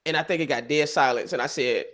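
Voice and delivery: normal talking voice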